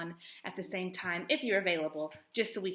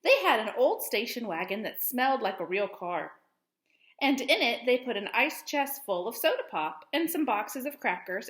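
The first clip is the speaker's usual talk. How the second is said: gentle storybook reading voice; expressive picture-book read-aloud; reading to children; 4 semitones higher, 7 dB louder than usual. Acted. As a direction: read it like story time for young kids. Teacher reads picture book aloud.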